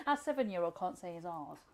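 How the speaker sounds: ordinary talk, nothing marked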